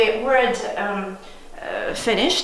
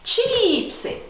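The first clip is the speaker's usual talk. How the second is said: CHIIIP-sy, first syllable high and long; stress on first syllable; 11 semitones higher than usual. Shown as a demonstration. Teacher showing where the stress is, first-syllable stress. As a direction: punch and stretch the start of Chipsy. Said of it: "Let's put a stress".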